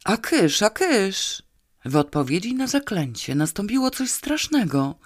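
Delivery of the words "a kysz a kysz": higher, horrified shooing; stifled horror. A narrator's reading. Drunk man shooing a hallucinated elephant, horrified. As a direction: shoo the apparition away in dread. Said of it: "powiedział ze zgrozą zduszonym głosem".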